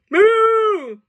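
high, loud, long moo; pig's moo. Acted. Pig teaching the cow, high loud moo. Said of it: "says the pig".

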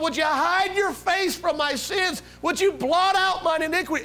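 high, straining plea; pleading with God. Retold or imitated. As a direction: beg desperately at the top of your range. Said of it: "As he's pleading".

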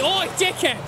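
lightly imitated street heckle, pitched high; mock heckler. Quoting how people usually address him, high mock heckle. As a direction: call out like someone hailing him rudely.

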